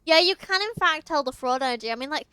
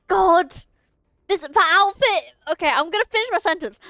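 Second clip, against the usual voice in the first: higher, louder flustered exclaim; flustered outburst; 4 semitones higher, 7 dB louder than usual. Real, not acted. Streamer tripping over words to chat, flustered and louder. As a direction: catch yourself mid-stumble. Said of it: "flustered at own slip".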